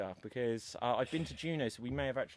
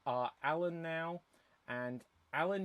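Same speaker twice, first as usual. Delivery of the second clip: ordinary delivery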